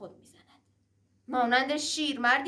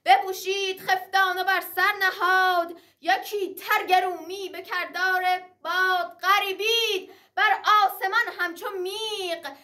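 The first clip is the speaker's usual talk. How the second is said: epic teahouse storyteller; 5 semitones higher than usual. Acted. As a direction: ring out the verse in triumph. Verse of Sohrab arming for battle. (نقالی حماسی بلند و پرطنین)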